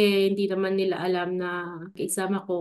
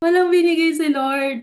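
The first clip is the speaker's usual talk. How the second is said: laughing; 7 semitones higher, 5 dB louder than usual. Real, not acted. Laughing about no sign.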